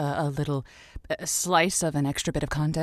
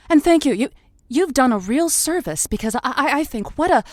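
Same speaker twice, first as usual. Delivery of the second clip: gushing host; 6 semitones higher, 4 dB louder than usual. Acted. Host gushes over caller.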